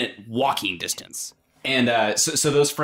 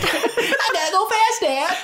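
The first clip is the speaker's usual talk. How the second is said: put-on high, laughing kid voice quoting Sonic; squeaky kid Sonic line. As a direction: play the little kid blurting the catchphrase at dad. Child quoting Sonic to dad.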